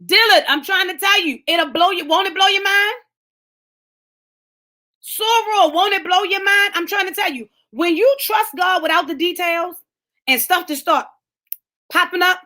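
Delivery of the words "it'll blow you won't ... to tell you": ordinary delivery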